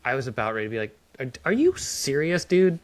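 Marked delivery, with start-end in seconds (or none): 1.2-2.8 higher, louder disbelief; incredulous outburst. Retold or imitated. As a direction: build to a loud, high "dude". Acts out near outburst in meeting.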